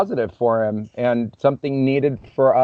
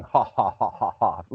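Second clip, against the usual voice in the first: low, spaced-out mock chuckle; imitated laugh; 5 semitones lower, 6 dB quieter than usual. Julio reacting to his thoughts, imitated low laughing. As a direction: imitate his laugh, dropping lower and softer. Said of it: "then he starts laughing".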